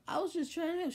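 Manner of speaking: high-pitched, pleading excuse; retold defensive protest. Doctor defending himself to angry mom, retold protest. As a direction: imitate someone protesting innocence.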